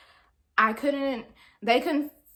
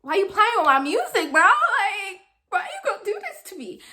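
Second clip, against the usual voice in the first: high, loud retold wail of protest; upset whine; 8 semitones higher, 4 dB louder than usual. Student upset over the missing iPod, wailing in protest. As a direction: whine and plead, pitch climbing very high. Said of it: "I'm upset 'cause I'm like".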